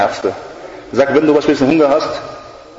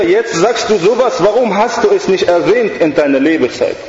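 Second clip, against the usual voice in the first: higher, taunting devil voice; mocking devil; 6 semitones higher than usual. The devil mocking the dying girl, higher and scornful. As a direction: sneer at her too-late regret. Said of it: "Der Teufel sagt zu ihr".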